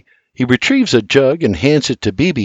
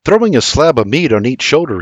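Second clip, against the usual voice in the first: plain reading voice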